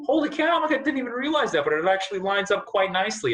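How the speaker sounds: like a sudden discovery, high and bright; realizing it lines up, excited surprise; amazed. Retold or imitated. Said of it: "And I was like".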